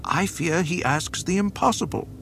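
high, anxious officer; nervous commander. Acted. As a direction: plead with rising worry.

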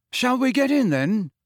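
high, tentative suggestion; hesitant boy's question; uncertain. A narrator's reading. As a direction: ask it gingerly, unsure. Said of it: "said Ron uncertainly".

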